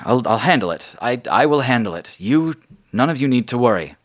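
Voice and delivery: falter, then promise firmly; Margot's reassurance. Acted. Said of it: "she says".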